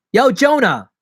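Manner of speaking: loud, high shout at a door; calling out. Acted. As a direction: holler for a friend to come out.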